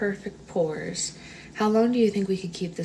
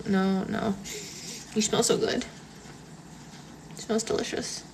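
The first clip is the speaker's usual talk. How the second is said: ordinary talk, nothing marked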